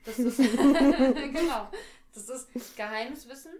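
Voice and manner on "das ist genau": drawn-out laugh, louder. Real, not acted. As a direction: laugh it out slowly. Laughs at own joke.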